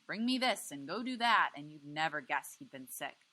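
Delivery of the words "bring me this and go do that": louder, higher bossy commands; pushy kid giving orders. A narrator's reading. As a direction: order him around like a little king. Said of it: "Freak is right away ordering me around".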